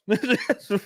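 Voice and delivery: uneasy high giggle; a short nervous laugh. Real, not acted. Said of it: "I know I'm laughing. It's like a nervous laugh".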